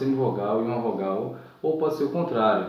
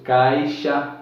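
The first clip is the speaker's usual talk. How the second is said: usual speaking voice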